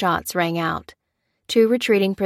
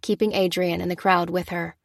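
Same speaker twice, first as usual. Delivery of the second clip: ordinary delivery, nothing marked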